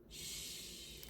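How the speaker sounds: soft long shush. Acted. Teacher hushing children after the bedtime story. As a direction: hush them gently to sleep.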